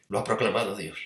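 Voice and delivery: usual speaking voice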